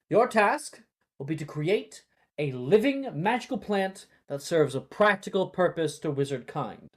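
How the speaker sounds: rally the students with cheerful energy; cheerful teacher setting the task; enthusiastic Neville. Acted. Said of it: "his voice filled with usual warmth and enthusiasm".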